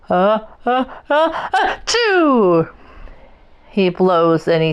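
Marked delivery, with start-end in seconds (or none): 0.1-2.7 high, stuttering sneeze building to a burst; the bear sneezing from pepper. A narrator's reading. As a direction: wind up to a big sneeze. Bear's big sneeze.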